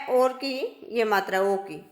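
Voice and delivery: usual speaking voice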